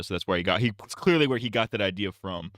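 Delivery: ordinary talk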